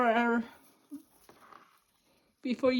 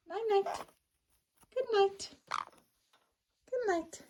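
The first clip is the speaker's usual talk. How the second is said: soft sing-song goodnight; 9 semitones higher, 4 dB quieter than usual. Real, not acted. Parent saying goodnight to baby.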